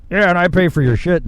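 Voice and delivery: mocking, pushy imitation; mock entitlement. Mimics complaining taxpayer to cop.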